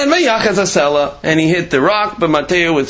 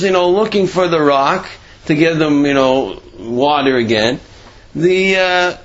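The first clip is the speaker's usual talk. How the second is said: ordinary delivery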